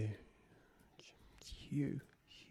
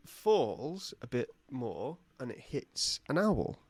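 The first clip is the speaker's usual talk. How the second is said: ordinary talk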